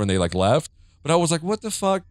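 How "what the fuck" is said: high disbelieving squeak; high incredulous. Retold or imitated. Her retold reaction.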